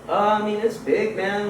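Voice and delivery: lightly voiced laid-back stoner. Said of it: "he's got, like, the stoner voice".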